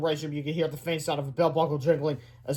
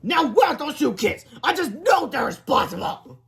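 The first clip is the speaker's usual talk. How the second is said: angry demand; 10 semitones higher, 4 dB louder than usual. A narrator's reading. Principal hunting two suspected kids, angry.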